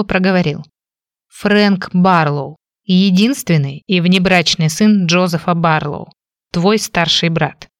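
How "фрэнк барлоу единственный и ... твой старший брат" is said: slow, clipped-apart words; measured, word by word. Acted. Brother reveals himself to her, spacing each word. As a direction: hammer out each word slowly. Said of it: "чеканя каждое слово".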